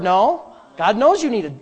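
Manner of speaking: normal talking voice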